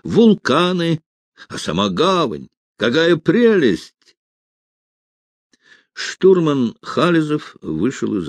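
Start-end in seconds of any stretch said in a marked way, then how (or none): 0.0-4.2 high, drawn-out admiration; enraptured cadet, voice lifted; ardent. A narrator's reading. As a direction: marvel slowly at each sight. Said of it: "горячо говорил юнкер Ухтомский".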